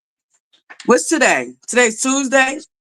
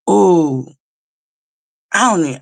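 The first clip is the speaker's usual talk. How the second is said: ordinary talk, nothing marked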